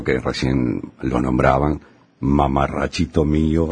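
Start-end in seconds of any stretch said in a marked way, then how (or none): 2.2-3.7 slow, lightly voiced mock catchphrase with a laugh; imitating Oscar Casco. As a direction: savor the old radio line slowly, half laughing. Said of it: "Recuerdo esa frase de chico".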